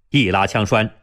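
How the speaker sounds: usual speaking voice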